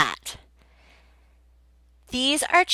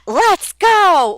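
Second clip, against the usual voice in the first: loud, high, slow upbeat classroom voice; cheerful kid teacher; 9 semitones higher, 7 dB louder than usual. Shown as a demonstration. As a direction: greet a young student brightly. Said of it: "Those are good".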